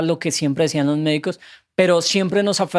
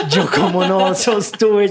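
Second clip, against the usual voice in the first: laughing disbelief; 2 semitones higher, slower than usual. Retold or imitated. Retelling wife's amazed reaction.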